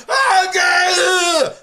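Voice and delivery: high, loud anguished wail; wailing scream. Retold or imitated. Convict losing it in court, wailing loudly. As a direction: let out a wild wail.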